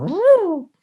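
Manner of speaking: high, loud whoop of delight; excited whoop. Retold or imitated. Mentor cheering about the book, loud high whoop. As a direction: let out a thrilled woo. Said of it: "That's how he said it".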